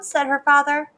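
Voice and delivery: plain reading voice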